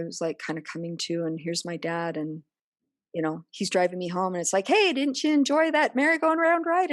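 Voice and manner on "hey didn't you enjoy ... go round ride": high, sing-song mock cheerful voice; falsely cheerful dad. Dad driving daughter home, high mock-cheerful voice. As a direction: brightly cover it up like nothing happened.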